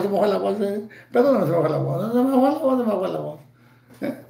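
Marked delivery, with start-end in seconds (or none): 2.1-3.4 voice cracking. Real, not acted.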